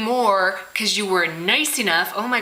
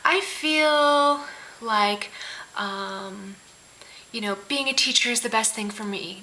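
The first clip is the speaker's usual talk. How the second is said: mock passive-aggressive; slower than usual. People who won't commit, mocked as passive-aggressive. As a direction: imitate someone hedging with long hesitations. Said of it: "it's such a passive aggressive".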